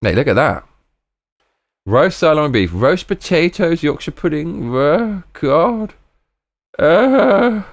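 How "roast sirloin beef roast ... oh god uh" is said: high-pitched swooning over the menu; real delight, pitched way up, ending in a long moan; ecstatic food craving. Real, not acted. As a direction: read each dish with rising, blissful longing. Reading roast menu in delight.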